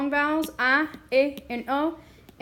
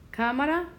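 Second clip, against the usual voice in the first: "Cámara" as CÁ-ma-ra, higher and rising; stress on first syllable; 4 semitones higher than usual. Shown as a demonstration. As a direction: hit CÁ in "Cámara", questioning lift. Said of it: "listen to where we hear the stress".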